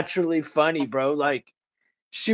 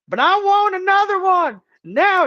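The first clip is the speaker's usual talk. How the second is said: put-on high, loud, whining old lady demanding more pills; whiny grandma demand; 5 dB louder than usual. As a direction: wail it like a feisty old grandma begging. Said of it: "She'd be like".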